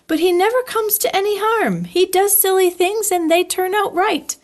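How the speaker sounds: fond little piglet musing. Acted. Piglet musing about Pooh.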